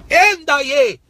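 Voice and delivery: high, loud ecstatic tongues; preacher erupting into tongues, high and loud; speaking in tongues. Real, not acted. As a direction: burst into tongues in the spirit. Speaking in tongues mid-sermon.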